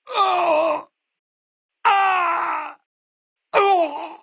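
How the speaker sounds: sharp high cries of pain; pained yelps. Acted. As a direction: yelp as each hit lands. Combat hits, pained yelps.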